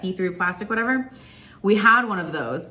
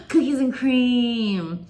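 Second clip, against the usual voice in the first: delighted; 4 semitones higher, much slower than usual. Real, not acted. Gasps at favorite flavor.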